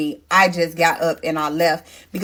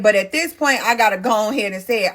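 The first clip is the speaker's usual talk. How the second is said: ordinary talk, nothing marked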